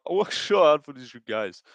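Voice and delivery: put-on Brazilian Portuguese accent with slushy S sounds; mock Brazilian accent, softening at the end; Pantoja impression. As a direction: do a Brazilian fighter's accented English. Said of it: "It's my Pantoja impression".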